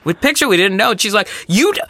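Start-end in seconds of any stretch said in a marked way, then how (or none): 0.0-0.9 lightly voiced flustered mom, higher pitch; mock flustered apology, starting high; imitated placating mom. Mom placating a screaming stranger. 1.5-1.8 high-pitched mock Southern scolding; hammed-up indignant threat, pitched up; imitated yelling. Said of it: "like, yelling at us".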